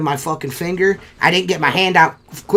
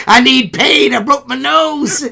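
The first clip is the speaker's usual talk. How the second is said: loud, much higher joking bellow; mock tough-guy yell; 9 semitones higher, 7 dB louder, slower than usual. Teasing friend about his broken nose, mock bellow. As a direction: bellow it out as a joke. Jokingly acts out the other's injury.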